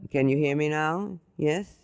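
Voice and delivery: ordinary talk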